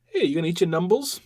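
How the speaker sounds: lightly voiced Cockney asking about numbles; little Cockney accent. Host teasing a cohost about numble pie, light Cockney accent. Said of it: "Little Cockney accent there".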